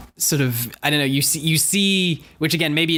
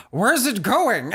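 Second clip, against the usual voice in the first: slow, higher, bewildered asking; distressed viewer voice; 5 semitones higher, much slower than usual. Retold or imitated. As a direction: drag it out like a lost moviegoer. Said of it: "It's my complete distressed viewer voice".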